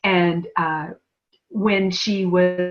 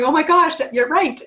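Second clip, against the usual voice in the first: mock realization; 10 semitones higher, 3 dB louder than usual. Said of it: "And her going".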